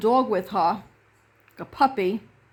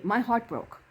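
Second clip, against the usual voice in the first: plain reading voice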